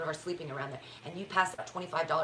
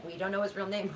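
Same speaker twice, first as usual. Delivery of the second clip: normal talking voice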